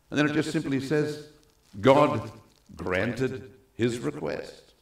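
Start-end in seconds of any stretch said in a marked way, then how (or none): none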